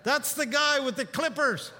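sheep talking. Pretend. Sheep complaining about the shepherd who sheared them, lightly voiced. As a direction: give the wounded sheep a wry little voice. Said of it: "they'd go like".

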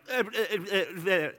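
stuck on a stammered eh, unable to get words out; mock stutter. Stammering at the angel, lost for words, mock stutter. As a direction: sputter like your brain outruns your mouth. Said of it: "stutter and just, like, go nuts".

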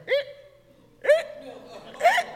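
very high creaky groan, like a stand slowly sinking; creaking mic stand. Retold or imitated. Pastor mimicking the sinking mic stand: high creaking squeal. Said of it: "the boom mic stand starts going".

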